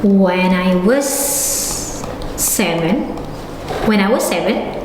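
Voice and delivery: low, slow, word-by-word dictation. Shown as a demonstration. Teacher gives class an example, slow dictation. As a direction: spell the sentence out in drawn-out pieces with long pauses.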